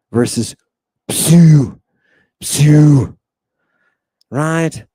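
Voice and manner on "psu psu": "psu" loud with the vowel held; long upsilon. Shown as a demonstration. Teacher isolating the long syllable for students, loud drawn-out "psu". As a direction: stretch out "psu" as "psyuuu". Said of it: "The vowels have different lengths".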